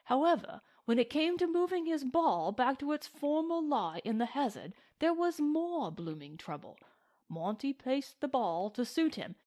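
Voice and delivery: high, flustered British toff; lively English gentleman with swooping high pitch; excited English gentleman. Acted. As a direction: recount a scandal as an agitated Englishman.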